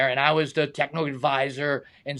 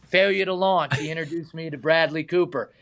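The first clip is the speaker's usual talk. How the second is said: ordinary talk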